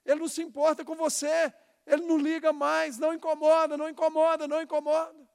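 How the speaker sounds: loud, high mocking taunt of the enemy. Preacher voicing the enemy of our dreams, loud mocking taunt. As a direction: sneer it like a voice whispering doubt. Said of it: "alguém tá dizendo pra você".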